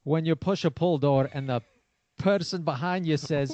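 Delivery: loud, thick mock-Scottish reading; Scottish accent. Shown as a demonstration. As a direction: read it out in a bold Scottish accent. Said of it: "I like that accent".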